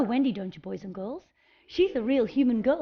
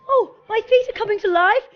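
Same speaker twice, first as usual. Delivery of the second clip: high, louder, joyful wonder; thrilled as your feet come alive; delighted; 8 semitones higher, 5 dB louder than usual. Acted. Fairy dancing on her toes for the children, delighted.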